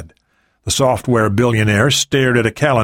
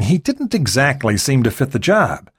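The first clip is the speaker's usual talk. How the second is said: plain reading voice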